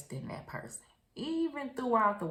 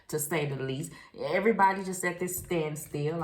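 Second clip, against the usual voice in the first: usual speaking voice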